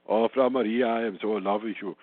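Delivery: lightly hammed-up Austrian accent, pitched a bit low; mock Austrian accent. Mocking how an actor could have played Sound of Music, mock Austrian accent. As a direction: put on a fake Austrian accent for a love line. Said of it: "do an Austrian accent".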